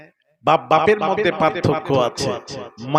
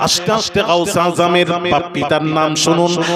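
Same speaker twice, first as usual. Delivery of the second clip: loud, ringing sermon chant; fervent loud proclamation; preaching at full force; 8 dB louder than usual. Acted. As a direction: proclaim it to the whole crowd. Loud preaching of saint's lineage.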